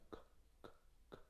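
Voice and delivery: very weak, quiet "크". Shown as a demonstration. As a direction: let "크" out faintly, with no full vowel. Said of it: "K 발음을 아주 약하게". (아주 약하게 내는 "크")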